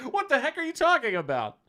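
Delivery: exasperated doctor. Retold or imitated. Doctor voiced.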